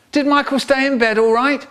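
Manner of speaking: high-pitched motherly question; high, inquiring mum; mum's voice. Acted. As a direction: voice a mum checking at the door. Mum asking at the door.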